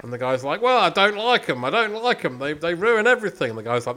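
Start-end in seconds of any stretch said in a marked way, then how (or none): 0.6-3.5 put-on landlord in a broad Australian accent, a bit higher and louder; grumbling landlord, Aussie twang. Landlord telling the visitors off, in an Australian accent. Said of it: "I'm gonna do my Australian accent again".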